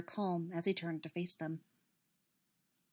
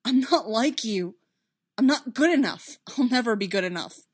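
loud, raised-pitch despair; anguished self-reproach; 4 semitones higher, 10 dB louder than usual. A narrator's reading. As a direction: burst out in self-blame, then fall away.

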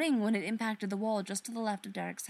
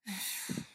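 weary sigh; 7 dB quieter than usual. Acted. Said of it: "she sighed".

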